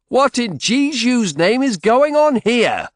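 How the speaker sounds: slow, loud, high angry demand; demanding angrily. Acted. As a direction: bark the question at the crowd. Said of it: "he demanded angrily".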